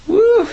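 high-pitched "woo" of delight; celebratory whoop. Retold or imitated. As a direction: whoop at a sudden windfall. Celebrating the windfall.

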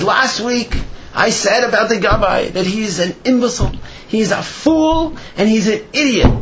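high-pitched retold proclamation to a congregation; mock public announcement. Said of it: "claps on the bima in front of everybody".

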